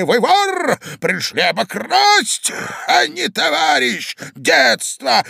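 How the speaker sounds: high, drawn-out accusation; old man shouting. A narrator's reading. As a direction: rage at a thief, stamping out words. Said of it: "кричал старик, топая ногами". (гневный крик старика)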